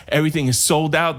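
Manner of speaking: ordinary delivery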